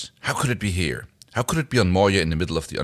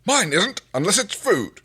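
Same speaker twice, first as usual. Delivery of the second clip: high, louder grumpy little alien; Rygel voice; 12 semitones higher, 3 dB louder than usual. Acted. Rygel caring only for food, high grumpy voice. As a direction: squeak it out, greedy and dismissive. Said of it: "We're just gonna do some voices".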